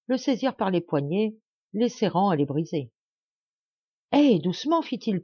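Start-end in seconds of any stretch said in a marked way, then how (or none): 4.1-4.8 higher-pitched, urgent call to ease off; startled protest. A narrator's reading. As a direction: protest as you are grabbed. Caporal seized, protests to captors.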